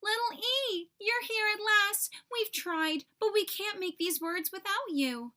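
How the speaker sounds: high, excited storybook cheer; joyful high-voiced welcome; cheering little letters. A narrator's reading. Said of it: "The little letters cheered".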